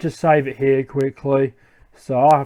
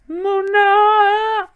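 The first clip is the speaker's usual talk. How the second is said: very high, drawn-out creature squeal; Pokemon cry. Acted. Pokemon crying out among villains, high squeal. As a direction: squeal the name as a creature call.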